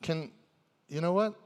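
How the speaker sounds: ordinary delivery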